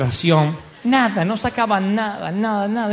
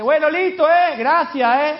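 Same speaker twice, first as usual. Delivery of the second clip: sarcastic thanks; 8 semitones higher, 4 dB louder, slower than usual. Retold or imitated. Fed-up fisherman calls back sarcastically.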